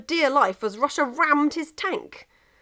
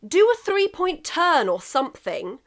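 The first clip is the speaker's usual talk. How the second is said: higher, impatient suggestion; exasperated England; 6 semitones higher, 4 dB louder than usual. Acted. England instructing Russia across the road, impatient. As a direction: tell him what to do. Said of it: "England advises Russia".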